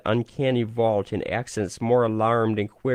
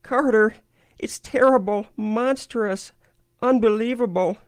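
high, loud frantic terror; frenzied; 11 semitones higher, 3 dB louder than usual. A narrator's reading. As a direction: cry it out in frenzied horror. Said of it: "Then came the frenzied tones again".

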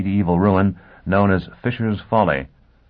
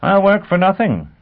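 gleeful; 12 semitones higher, 4 dB louder than usual. A narrator's reading. Dagenham relishing the chase.

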